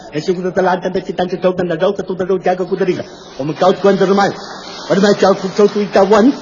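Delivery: rapid fake Russian patter; mock Russian gibberish. Shown as a demonstration. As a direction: babble fast as if fluent in Russian. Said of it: "我站着能说一宿".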